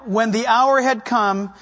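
usual speaking voice

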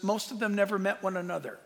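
ordinary talk

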